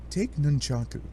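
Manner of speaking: usual speaking voice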